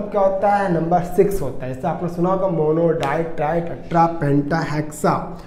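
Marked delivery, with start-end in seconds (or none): none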